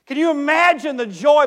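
loud, high impassioned preaching; preacher lifting the congregation; full-force preaching. Acted. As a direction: preach it out with fervour.

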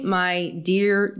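normal talking voice

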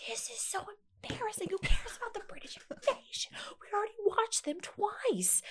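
very high, whispery mock whine; imitating embarrassed teen. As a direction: complain to mom like a mortified teenager. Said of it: "so embarrassed".

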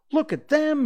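very high, loud exclamation; high-pitched, urgent shout; crying out. Acted. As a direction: cry out in astonishment at a sight. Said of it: "he cried".

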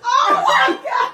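Oh, my God, loud, high and slow. Real, not acted. Oh, my God at the Tiana joke, loud and high. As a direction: shout Oh, my God stretched out. Louder repeat.

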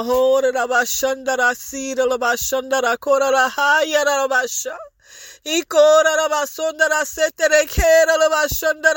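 rapid rhythmic tongues rising in pitch; speaking in tongues. Real, not acted. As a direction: let the syllables flow in ecstatic prayer. Speaking in tongues during prayer.

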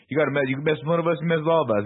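quick, high-pitched mock New Yorker threat; mock defiant bystander. Acting out a movie bystander.

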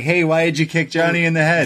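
TMZ reporter. Retold or imitated. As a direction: imitate a reporter hailing a celebrity. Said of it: "TMZ's like".

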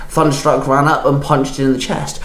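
ordinary delivery

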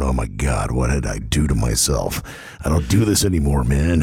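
low, groaning hungover self. Retold or imitated. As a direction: moan it out, wrecked. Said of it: "just sitting at my desk going".

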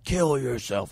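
lightly voiced horror movie killer; a hint of a creepy slasher voice; Scream killer voice. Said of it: "like, the Scream scary voice".

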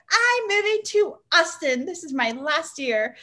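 high, bright-voiced announcement, slowing at the end; excited declaration. [name] telling friends she's moving, high and excited. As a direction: proclaim it high, then slow down. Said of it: "And I was like".

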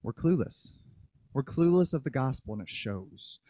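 low, soft, sinking away; hushed admission. Real, not acted. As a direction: let it sink to a near mumble. Quiet admission after shouted scolding.

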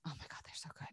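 breathless whispered gush. Real, not acted. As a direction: whisper it in delight. Gushing over scented reed sticks.

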